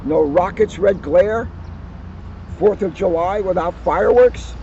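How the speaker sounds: put-on cheerful children's book narration; story reading. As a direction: read it like story time for kids. Reads picture book aloud to audience.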